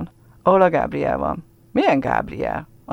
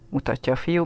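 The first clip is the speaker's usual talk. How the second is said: plain reading voice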